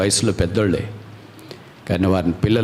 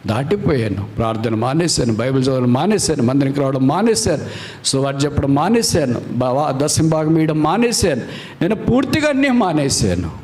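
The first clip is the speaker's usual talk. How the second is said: climbs steadily to a much higher cry; building confession; 5 semitones higher than usual. Acted. Preacher voicing a penitent admitting everything to the Lord, building. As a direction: climb with each failure.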